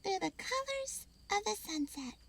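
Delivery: hushed wonder; squirrel voice. Acted. Pip squirrel closing line.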